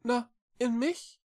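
high little cat voice, questioning; shy. A narrator's reading. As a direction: offer it timidly, rising at the end. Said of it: "sagte Echo schüchtern". (schüchterne, hohe Katerstimme)

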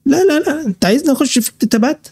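high, fast, sneering refusal; scoffing high-pitched brush-off; mocking a scoffing commenter. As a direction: mimic the know-it-all who waves off the offer. Mocking the hostile commenter's reply.

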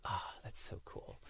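soft, breathed-out admiration; quiet awe. Retold or imitated. Recalls admiring reaction to her.

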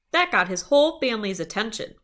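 ordinary delivery

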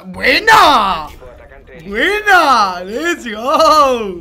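loud cheering; cheering shout. Real, not acted. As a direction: yell the win out loud. Cheering a teammate's kill.

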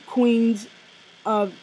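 ordinary talk, nothing marked

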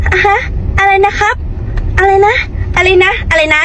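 high, loud, feigned confusion; mocking clerk. Shop clerk mocking the customer, high and loud. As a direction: act out the clerk pretending not to understand. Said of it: "ก็ว่า pencil อ้าว กูคือในใจ".

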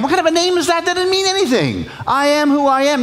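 incredulous Moses. Acted. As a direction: scoff at the silly name.

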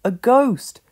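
loud, high alarmed cry; shout. A narrator's reading. Pat yells at the whirling sheets. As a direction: yell in fright at the ghost. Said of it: "shouted Pat".

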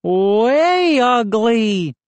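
high, drawn-out booming taunt. A narrator's reading. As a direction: stretch it out slowly and boom it. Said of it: "he boomed".

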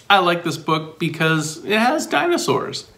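ordinary delivery, nothing marked

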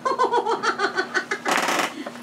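high teasing piggy laugh; pigs laughing. A narrator's reading. Lazy pigs laugh at their brother, high teasing giggle. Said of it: "Laughed the two lazy little pigs".

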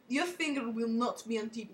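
normal talking voice

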